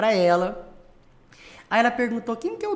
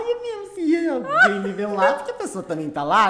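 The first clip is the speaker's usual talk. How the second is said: laughing realization; 5 semitones higher, 4 dB louder than usual. Retold or imitated. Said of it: "Eu falei, ai".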